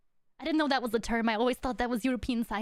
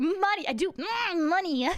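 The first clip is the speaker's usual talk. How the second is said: higher, relishing mock craving for money; imitating money-hungry chat; 6 semitones higher, slower than usual. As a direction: savour the word money, pitched up. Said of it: "Chad just going".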